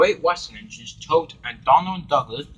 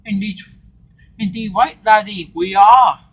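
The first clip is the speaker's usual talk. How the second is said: put-on Scottish accent; 8 semitones higher, 9 dB louder than usual. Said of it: "Donald Douglas are Scottish".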